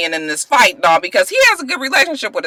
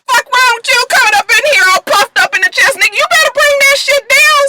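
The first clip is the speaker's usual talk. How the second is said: Screwface; 6 semitones higher than usual. Retold or imitated. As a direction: puff up and scold him like a studio boss. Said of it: "And Screwface was like".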